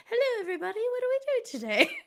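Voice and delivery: very high, put-on greeting voice; squeaky-high mock of someone's offstage voice; imitated real voice. Teasing the host about his real voice, mock high greeting. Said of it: "are you gonna be like".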